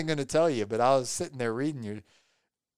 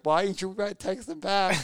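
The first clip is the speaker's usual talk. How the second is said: higher-pitched imitation of a mad wife; mock annoyed; 5 semitones higher than usual. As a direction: lightly act out an impatient complaint. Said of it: "she's getting mad".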